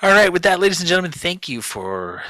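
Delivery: big, loud, high announcer voice; announcer sign-off. Acted. Host booming the sign-off to the listeners, announcer style. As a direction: boom it out like a show host wrapping up.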